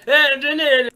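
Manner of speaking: put-on loud, high, sloppy drunk singing; drunken man. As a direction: ham up a happy drunk slurring a tune. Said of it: "the full-on drunken man".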